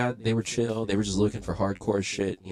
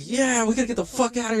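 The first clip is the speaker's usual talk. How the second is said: high, hurried urging; urgent; 14 semitones higher, faster than usual. Retold or imitated. Urging the girlfriend to flee the scene, high and urgent. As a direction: push everyone to flee now.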